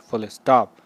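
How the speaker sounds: normal talking voice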